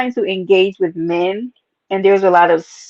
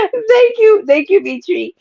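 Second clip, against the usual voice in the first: high, teary laugh into thank you; choked-up thanks; 11 semitones higher than usual. Real, not acted. Thanking Mitri for kind words, teary and choked up. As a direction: laugh through happy tears. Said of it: "I am verklempt".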